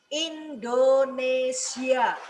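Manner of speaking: Indonesia drawn out slowly, each syllable fully sounded; slow, carefully articulated Indonesia; clear articulation. Shown as a demonstration. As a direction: stretch out every syllable of Indonesia. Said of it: "Itu termasuk artikulasi".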